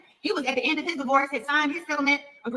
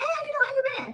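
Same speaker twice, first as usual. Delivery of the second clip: high bright greeting; 14 semitones higher than usual. Retold or imitated. [name] quoting her own greeting.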